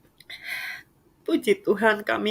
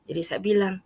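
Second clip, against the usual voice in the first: ordinary talk, nothing marked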